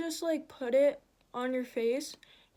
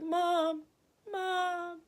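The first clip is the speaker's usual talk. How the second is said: high strained call for mom; imitating a voiceless scream; failed scream; 5 semitones higher than usual. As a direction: call out weakly through pain. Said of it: "I couldn't scream that well".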